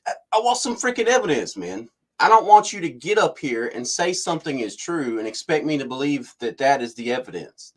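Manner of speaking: high-pitched mock exasperation, easing down gradually; exasperated demand. Reporter demanding real evidence from the spokesman, exasperated. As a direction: act out a fed-up reporter pushing back.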